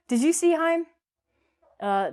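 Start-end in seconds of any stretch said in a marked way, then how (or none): 0.1-0.9 "Heim" for him, rhyming with time, high and loud; wrong long I. Shown as a demonstration. Teacher jokes with wrong him in a question, long I. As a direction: ask it brightly with "Heim" as the silly mistake. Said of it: "We don't say".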